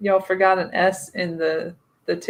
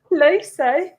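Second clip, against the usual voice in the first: put-on Cockney accent; 7 semitones higher, 5 dB louder than usual. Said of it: "my Cockney accent".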